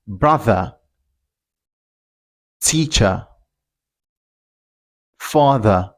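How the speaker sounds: British 'brother, teacher, father' ending in 'uh'; silent final R. Shown as a demonstration. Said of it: "you don't pronounce the R".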